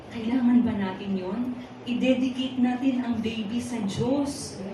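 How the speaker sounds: ordinary delivery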